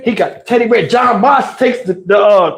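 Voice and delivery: ordinary delivery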